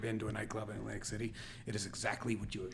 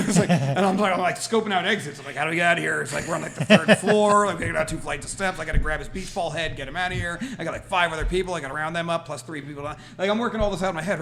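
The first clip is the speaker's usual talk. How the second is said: raised, loud, urgent planning; retold mild panic; 4 semitones higher, 9 dB louder than usual. Big brother working out how to get everyone out, mock panic. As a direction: reel off an escape plan, comically frantic.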